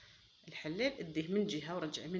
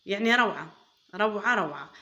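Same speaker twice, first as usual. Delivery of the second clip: excited praise; 9 dB louder than usual. Real, not acted. Praising her finished dish.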